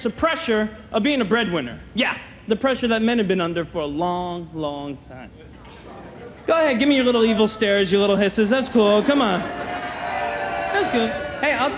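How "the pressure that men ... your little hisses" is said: ordinary talk, nothing marked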